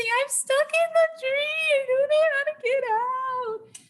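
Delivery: high, wailing call from far up; mock crying scream. Stuck child answers mom, imitated tearful yelling. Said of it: "I am crying and I'm screaming".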